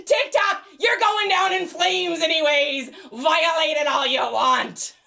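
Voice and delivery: loud, slow defiant taunt. Real, not acted. Defying TikTok over the violation, slow and loud. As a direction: dare them, slowly and loudly.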